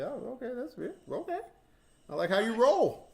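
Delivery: high, hammed-up surprised voice, ending loud; mock surprise. Retelling his reaction to a deposit bonus, mock delighted surprise. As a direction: play a giddy, taken-aback newbie.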